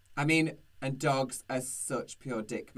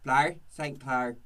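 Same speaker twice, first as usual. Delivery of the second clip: put-on Irish accent; slower than usual. Host answers the guest with a slow, mild Irish accent. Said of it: "Um, Irish".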